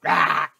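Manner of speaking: sudden loud yelp as the drawers rip; a sharp, loud cry of pain. Acted. Drawers tearing during the wedgie, loud yelp.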